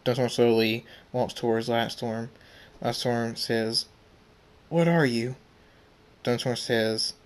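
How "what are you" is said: higher, alarmed question; fearful question. Acted. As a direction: ask it in dawning fear. Backing from a frightening foe.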